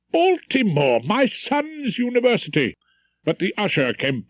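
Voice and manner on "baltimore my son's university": delighted surprise. Acted. Hears his son's university named.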